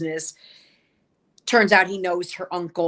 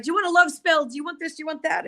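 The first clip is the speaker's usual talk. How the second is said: high mock salesman, accent given up; shopkeeper's sales patter; 10 semitones higher, much faster than usual. Shopkeeper offering Jenny one thing after another, mock sales patter. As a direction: pitch it high without attempting the accent. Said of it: "I can't do an accent, but he's like".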